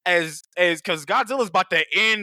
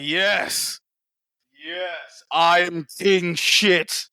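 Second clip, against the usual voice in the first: slow gloating villain voice; gloating Scar King; much slower than usual. Pretend. Scar King gloating while riding Shimu, slow gloat. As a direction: savor your triumph.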